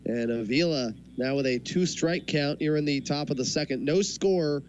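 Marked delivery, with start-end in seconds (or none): none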